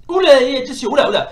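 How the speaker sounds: high, loud quick warnings; startled gamer warning; alarmed yelps. Real, not acted. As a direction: yelp as the attack comes. Under attack in game.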